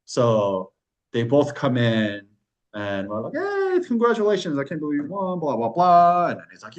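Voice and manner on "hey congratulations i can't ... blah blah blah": mock cheering congratulations. Staff congratulating the award winner, imitated gushing. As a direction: gush in a high voice, tossing it off.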